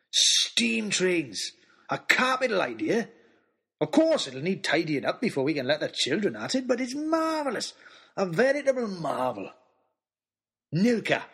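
put-on booming, delighted old giant greeting the boys; a big jovial old man, bursting with joy; jolly giant man. Christmas man voiced in story.

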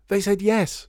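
high, loud joyful shout; a girl shouting her news as she runs in. A narrator's reading. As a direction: burst in yelling the good news. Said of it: "shouted Lydia".

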